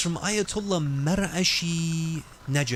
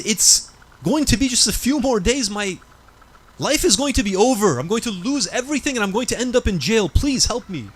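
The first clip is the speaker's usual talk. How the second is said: desperate plea; 6 semitones higher, 3 dB louder than usual. Retold or imitated. Young man fears jail, begs.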